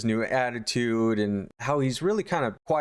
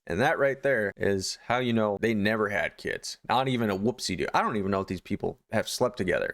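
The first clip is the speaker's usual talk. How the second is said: normal talking voice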